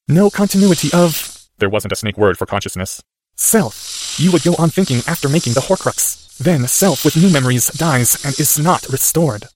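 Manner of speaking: put-on a boy working it out; young boy voice. A narrator's reading. Harry in Parseltongue.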